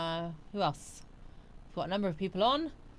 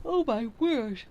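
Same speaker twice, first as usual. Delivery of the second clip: words stretched by a yawn, higher and louder; 4 semitones higher, 5 dB louder, slower than usual. Real, not acted. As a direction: exclaim through a real yawn. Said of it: "I've not been yawning".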